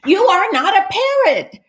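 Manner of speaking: very high, insistent denial; high-pitched scolding of a copycat; exasperated cat. Acted. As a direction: protest high and fed up. Exasperated cat contradicts duck.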